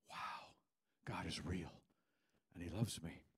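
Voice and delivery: low, hushed wonder; quiet awe. Acted. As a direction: murmur it as a dawning realisation. Voices awed inner realisation.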